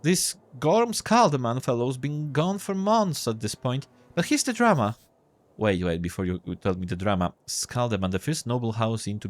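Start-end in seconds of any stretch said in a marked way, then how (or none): 0.0-5.0 put-on high-pitched boy reading the paper aloud; newsreading kiosk boy. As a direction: share the news in a high young voice. Kiosk boy reads the news.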